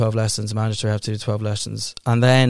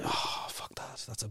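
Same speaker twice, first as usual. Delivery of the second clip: slow, whispered and much softer; whispered dismay; 2 semitones lower, 19 dB quieter, slower than usual. Real, not acted. Hearing about the six-month wait, a slow whisper. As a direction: breathe it out in disgust.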